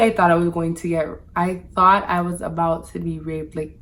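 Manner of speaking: normal talking voice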